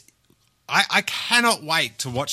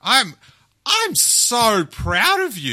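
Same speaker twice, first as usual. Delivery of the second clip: higher, louder, slow gushing; gushing pride; 8 semitones higher, 5 dB louder, slower than usual. Real, not acted. Host telling actor she's proud, high slow gush. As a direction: beam it out, drawn out and elated.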